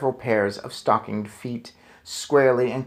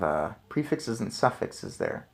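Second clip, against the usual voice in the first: plain reading voice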